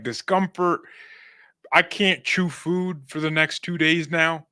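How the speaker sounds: ordinary delivery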